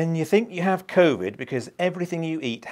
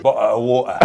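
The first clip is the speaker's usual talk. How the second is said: put-on London accent, "Bottle of water" with the Ts dropped as glottal stops; 6 dB louder than usual. [name] mimics the London accent from the meme, dropping his Ts. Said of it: "the London accent".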